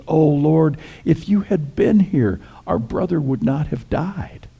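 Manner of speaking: high-pitched, mildly pleading lament; grieving reproach. Acted. Martha meets Jesus after her brother's death, grieving reproach.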